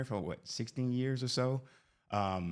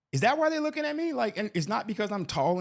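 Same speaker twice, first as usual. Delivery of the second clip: very high, louder comic question; incredulous mock outrage; 11 semitones higher, 5 dB louder than usual. Husband realising why colleagues look at him, incredulous mock outrage. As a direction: squeal the question in mock disbelief.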